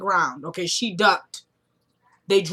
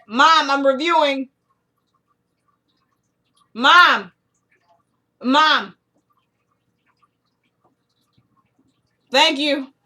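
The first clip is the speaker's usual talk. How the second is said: calling out to mom; 5 semitones higher, 5 dB louder, slower than usual. Real, not acted.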